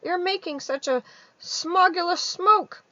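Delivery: high, loud complaining creature voice; querulous Lorax. Acted. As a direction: scold the Onceler, whining about the smog. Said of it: "he cried with a querulous croak".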